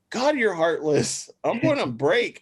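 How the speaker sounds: playful imitation of a hurt reply; wounded retort. His wounded retort to the boss.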